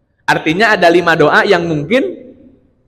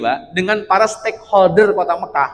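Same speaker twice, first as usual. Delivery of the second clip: ordinary talk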